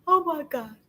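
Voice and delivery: high-pitched mock weeping; imitated tearful outburst. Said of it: "I bust out in tears".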